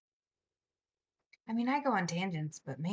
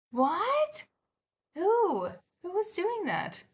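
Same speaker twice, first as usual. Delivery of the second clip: high, louder startled questions; surprised what; 11 semitones higher, 5 dB louder, much slower than usual. Real, not acted. Streamer startled by a chat comment about yelling, surprised. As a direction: jump up in pitch, baffled.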